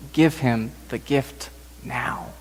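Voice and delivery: soft, slow inner voice; quiet prompting. Acted. Inner voice prompting her to give.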